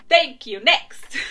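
loud, punchy send-off; brusque dismissal. Acted. Worker dismissing a complaining customer, loud and brusque. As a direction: send the customer away at full voice.

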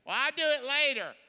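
mock excuse. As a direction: play the guy who keeps putting it off. Mocking a procrastinator's excuse.

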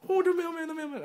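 very high, loud giddy squeal; hammed-up, high-pitched giddy outburst; giddy excitement. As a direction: squeal it, re-enacting sheer delight. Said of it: "I got all giddy".

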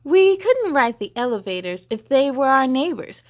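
put-on lively read-aloud to children; story reading. A narrator's reading. Reading the hippo book to children, lively storybook voice. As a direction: read it bright and sing-song for kids.

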